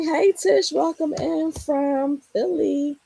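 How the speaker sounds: delighted high greeting to a viewer; excited hello. Real, not acted.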